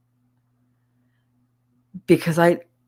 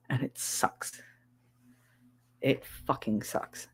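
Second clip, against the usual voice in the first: whispered complaint; 4 semitones lower, 12 dB quieter than usual. Real, not acted. Bitter complaint trailing off.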